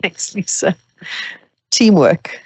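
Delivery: ordinary talk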